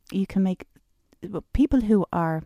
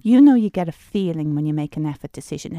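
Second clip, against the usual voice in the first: ordinary talk, nothing marked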